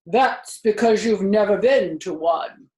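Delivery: lightly voiced old sheep, knowing; old sheep remarking. A narrator's reading. Old sheep teasing the rat, lightly voiced. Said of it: "remarked the old sheep".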